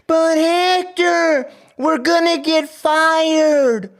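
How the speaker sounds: high, slow, whining mock voice; whiny guard mockery. Mocking a scared guard who fears getting fired, whiny. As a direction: whimper it like a scared weenie. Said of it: "They're mad".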